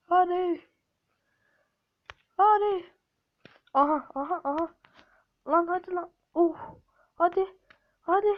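tense cheering; rising urging. Real, not acted. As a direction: push the character on. Tension rising during attempt.

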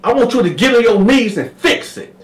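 high loud command; Sweet Lo demanding. Retold or imitated. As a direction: order him down. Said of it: "Sweet Lo was like".

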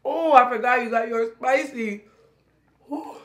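talking while chewing; mouth full. Real, not acted. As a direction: hand covering mouth. Seen 1: Woman chews food, hand over mouth, then talks freely after 2.8.

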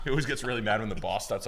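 normal talking voice

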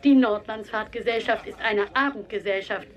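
ordinary talk

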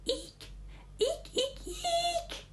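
very high squeaks; rat squeak. A narrator's reading. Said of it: "he said".

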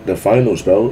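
usual speaking voice

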